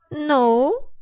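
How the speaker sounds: lightly voiced child; a hint of a little girl. A narrator's reading. Camila answers the doctor.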